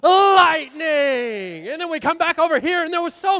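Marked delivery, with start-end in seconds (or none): 0.0-1.7 "Lightning!" drawn out and louder; called-out Lightning. Shown as a demonstration. Demonstrating the lightning cue for the kids, drawn-out call. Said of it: "it goes".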